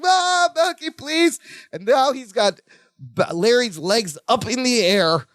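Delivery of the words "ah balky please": high, wailing imitation at normal volume; mock pained scream. Larry begging as they twist him again, high mock wail. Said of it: "And Larry screams again".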